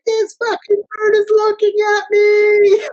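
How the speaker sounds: high, drawn-out hysterical mock shriek; losing his mind. Driver retelling being stared at by bird, hysterical. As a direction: screech it in hammed-up panic. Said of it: "losing my mind".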